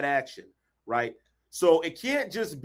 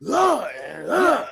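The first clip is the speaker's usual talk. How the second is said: mock ranting grunts, louder; imitating fiery speech; 3 semitones higher, 4 dB louder than usual. Imitating a dictator's fiery speech to show energy. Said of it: "Look at how he talked".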